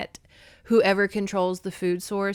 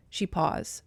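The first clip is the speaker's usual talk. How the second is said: normal talking voice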